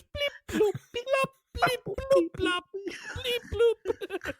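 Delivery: high, squeaky electronic bleeps; playful high-pitched bleeping; blip-bloop imitation. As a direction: sing out little synth blips. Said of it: "that goes".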